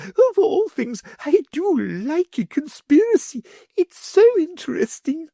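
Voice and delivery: high, sugary lady's voice; greedy wife in a high fluting voice; the Wardeness. A narrator's reading.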